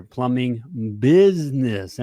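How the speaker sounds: "business" drawn out slowly for emphasis; stress on BUSINESS. Shown as a demonstration. Host spelling out what the booklet is about, stressing "business". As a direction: lean long and hard on "business". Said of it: "emphasis on business".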